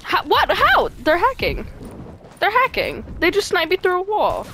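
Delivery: outraged complaint. Real, not acted. Outraged at hackers.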